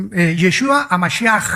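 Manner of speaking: "Yeshua a Mashiach" with a throaty ch at the end; preacher voicing the Hebrew name; Hebrew pronunciation. Shown as a demonstration. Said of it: "se pronuncia la C H".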